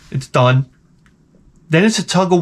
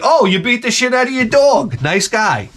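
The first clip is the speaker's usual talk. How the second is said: mock accusation; 6 semitones higher than usual. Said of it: "they're like".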